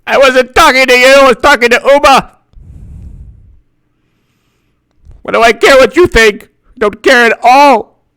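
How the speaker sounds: high, snippy put-on voice; high-pitched retort. Told off for answering, high huffy retort. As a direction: snap back in a high comic voice.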